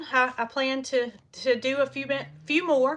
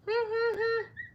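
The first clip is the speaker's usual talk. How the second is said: high laugh; 11 semitones higher, 3 dB quieter than usual. Real, not acted. Laughs at her own remark.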